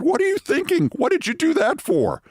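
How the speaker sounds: incredulous. Retold or imitated. Incredulous reply to her offer.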